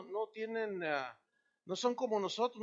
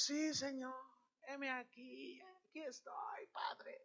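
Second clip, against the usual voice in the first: softer, fading into a reverent whisper; devout hushed prayer; 4 semitones higher, 9 dB quieter, slower than usual. Retold or imitated. A believer answering God, fading to a hushed whisper. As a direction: breathe it like a humble prayer.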